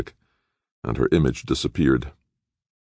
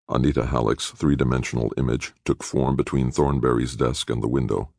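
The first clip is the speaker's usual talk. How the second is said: plain reading voice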